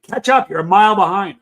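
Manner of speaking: half-joking shout to hurry up; imagined shout. Retold or imitated. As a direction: bark it like an impatient friend hurrying you. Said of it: "Tommy would be screaming at me".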